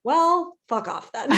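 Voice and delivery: high, loud mock snap, half joking; mock fuck-off. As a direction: playfully act the fed-up brush-off. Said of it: "that's where I'd be like".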